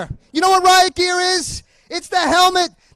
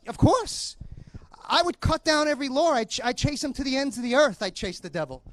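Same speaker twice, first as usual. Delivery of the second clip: lightly voiced young man; 4 semitones lower, 10 dB quieter than usual. Voicing young man replying.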